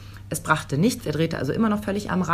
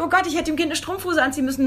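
panisch; 9 semitones higher, faster than usual. Retold or imitated. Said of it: "völlig fertig mit der Welt".

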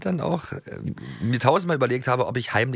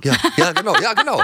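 laughing agreement; 5 dB louder, faster than usual. Real, not acted.

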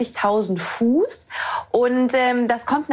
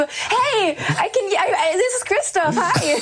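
mock excited; 10 semitones higher, slower than usual. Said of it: "Und du so".

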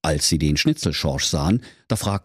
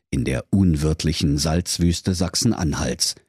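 usual speaking voice

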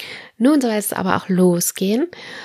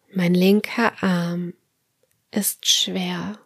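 guided meditation; slower than usual. Acted. Leads autogenic training relaxation.

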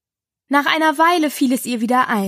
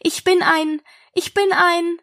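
put-on higher, searching child voice; faltering Alice; 7 semitones higher than usual. A narrator's reading. Alice tries naming what she is, faltering. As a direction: break off as if stuck.

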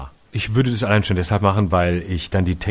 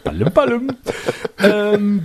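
silly high voice; 11 semitones higher, slower than usual. Pretend.

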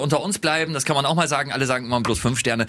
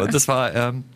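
normal talking voice

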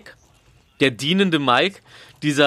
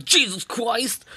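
imitating his fans; 6 semitones higher, much slower than usual. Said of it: "alle immer".

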